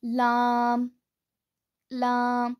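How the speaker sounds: लाम light, tongue edges to the gums of the top teeth; लाम: light l, long a; Arabic lam. Shown as a demonstration. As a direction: say लाम thin, not heavy. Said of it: "ज़ुबान के किनारे को ऊपर के चार दांतों के मसूड़ों".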